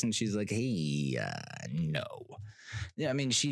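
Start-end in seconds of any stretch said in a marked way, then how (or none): 0.5-2.1 low, hesitant, drawn-out mock polite no; reluctant sister, voice dropping away; polite reluctant refusal. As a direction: imitate someone letting you down politely, sinking low. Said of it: "she was very polite".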